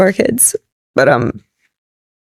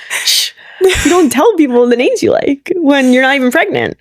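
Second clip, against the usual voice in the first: higher, hushing playful scold; mock scolding; 5 semitones higher than usual. Scolding her husband for giving away their baby names, higher and playfully hushing. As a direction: shush him and tell him off lightly.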